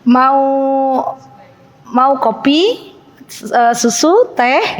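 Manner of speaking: slow, sweet, drawn-out drink offer; stewardess voice. Shown as a demonstration. Asked to demo, she offers drinks slowly like a stewardess. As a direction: stretch each word like a flight attendant. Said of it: "Gimana Mbak Ika?".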